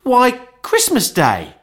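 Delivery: put-on high, slow boy's answer; drawn-out, high-pitched obviousness; astonished boy. A narrator's reading. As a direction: answer as a boy amazed anyone could ask. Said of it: "replied the boy".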